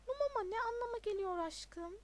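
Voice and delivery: put-on coy girlfriend. A narrator's reading. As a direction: play the puzzled, doting girlfriend. Girlfriend asks boyfriend about new name.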